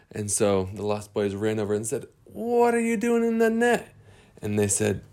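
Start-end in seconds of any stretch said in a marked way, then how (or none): 2.2-3.9 high soft puzzled question; high quiet character voice. Acted. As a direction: ask with surprise. Lost Boys find them netted.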